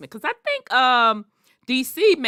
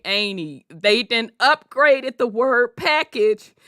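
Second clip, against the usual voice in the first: higher, louder, laughing lecture; loud explaining to auntie; 5 semitones higher, 5 dB louder than usual. Retold or imitated. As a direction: set auntie straight with a loud grin. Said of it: "I'm like".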